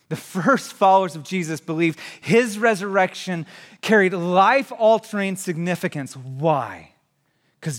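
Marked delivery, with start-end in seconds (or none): 0.1-6.2 raised, emphatic repeat; louder restatement. Shown as a demonstration. Pastor repeats the claim to the congregation, louder and emphatic. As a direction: say it again, louder and higher, driving it home. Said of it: "said first softly, now repeated".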